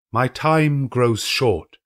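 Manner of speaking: a slow and solemn warning from a spirit; spirit's warning. A narrator's reading. The spirit warns Scrooge time is short, slowly.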